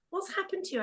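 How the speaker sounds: put-on high, hushed puzzled kid voice; a bewildered boy asking his mum; puzzled son.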